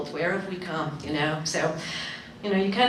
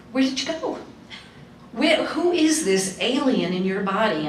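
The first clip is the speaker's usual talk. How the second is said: mock astonishment; 3 semitones higher than usual. Mother quoting her shock at daughter.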